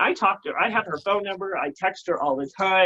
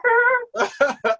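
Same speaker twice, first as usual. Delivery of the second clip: high tearful laugh; laugh-cry breaking up; laughing through tears; 4 dB louder than usual. Real, not acted. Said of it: "you got me ugly crying".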